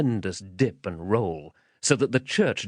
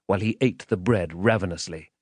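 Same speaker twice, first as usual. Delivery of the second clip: ordinary delivery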